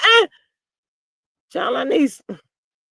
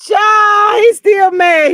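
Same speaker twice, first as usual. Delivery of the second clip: high, loud crowing; gleeful exclamation; 7 semitones higher, 4 dB louder, much slower than usual. Real, not acted. Host gloating that he's still mad, gleeful exclamation. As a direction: crow it out after a laugh. Gleeful outburst after laughing.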